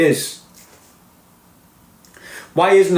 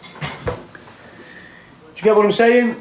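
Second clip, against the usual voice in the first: slow, much higher, stretched-out question; drawn-out challenge; 9 semitones higher, much slower than usual. Real, not acted. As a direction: drag it out high to drive the point home. Rhetorical challenge to viewers.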